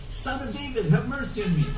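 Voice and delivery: high-pitched plea without real volume; shouting. A narrator's reading. Preacher reads two blind men calling after Jesus, restrained shout.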